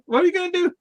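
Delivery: high-pitched cartoon question; Bugs Bunny. Cartoon rabbit questioning the Martian, high mock voice. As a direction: pitch it up as a quoted cartoon character. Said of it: "Bugs, like, he was like".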